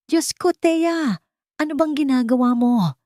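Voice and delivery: Doña Solen's staged scandalized yell; shocked shout. Acted. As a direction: shriek at her as if catching her in the act. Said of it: "Sigaw ni Doña Solen".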